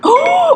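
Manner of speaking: loud surprised gasp; sharp gasp. Real, not acted. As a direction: gasp in shock. Siri responds.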